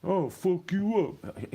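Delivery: high, slow mocking threat; mocking tough guy. Mocking the driver who cut him off, slow and high. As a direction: drag it out like a dumb tough guy. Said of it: "He came up to the window".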